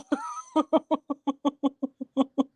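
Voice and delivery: high squealing laugh of disgust. Real, not acted. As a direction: laugh in horror at the picture. Reacts to gross picture.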